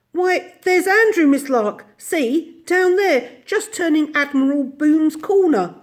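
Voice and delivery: put-on high, loud eager boy's cry; excited, urgent announcement; excited pointing-out. A narrator's reading. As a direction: call out the sighting, excitedly. Michael points out the dog.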